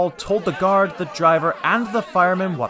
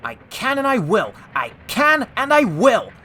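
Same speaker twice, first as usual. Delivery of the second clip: high, effortful determination, building louder; puffing; 9 semitones higher, 6 dB louder than usual. A narrator's reading. James pulling against the trucks, puffing determinedly. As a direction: push each word, rising in force. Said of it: "puffed James".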